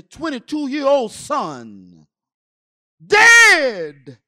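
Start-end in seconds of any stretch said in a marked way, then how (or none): 3.1-4.2 loud, stunned outburst of grief; anguished outcry. Retold or imitated. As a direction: cry out the terrible news. Relives news of son's death.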